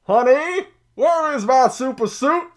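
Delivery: a hint of an exasperated hero shouting for his wife; Sam Jackson. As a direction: call it out like a loud movie quote. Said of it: "we can get Sam Jackson saying".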